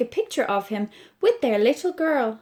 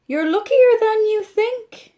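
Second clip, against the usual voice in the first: much higher, bright doctor voice; a kindly doctor's higher voice; cheery reassurance; 10 semitones higher, 6 dB louder than usual. Acted. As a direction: cheer up the patient.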